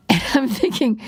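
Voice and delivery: usual speaking voice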